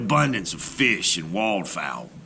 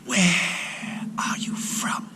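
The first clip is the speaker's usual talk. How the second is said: slow, airy serpent whisper; 8 semitones higher, 4 dB quieter, slower than usual. A narrator's reading. Serpent threatens the shipwrecked sailor in a whisper. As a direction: breathe it out like an ancient snake. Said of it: "The serpent spoke".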